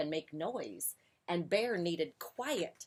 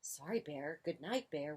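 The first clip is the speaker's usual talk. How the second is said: soft, small apology; whispered; 2 semitones lower, 7 dB quieter than usual. A narrator's reading. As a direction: say it hushed so Bear can sleep. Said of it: "whispered Mouse".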